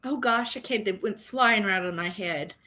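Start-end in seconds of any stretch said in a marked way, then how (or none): none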